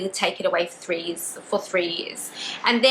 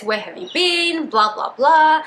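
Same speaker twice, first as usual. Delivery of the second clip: higher-pitched mock-frantic questioning; 6 semitones higher, 4 dB louder, slower than usual. Dad asking his long-missing daughter on the phone, mock frantic. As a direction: lightly imitate a frantic parent.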